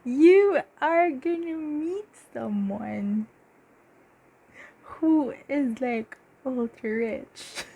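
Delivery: high, laughing giddiness; high, slow, giddy gushing. Real, not acted. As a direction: gush with delight over the news. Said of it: "I'm feeling so giddy".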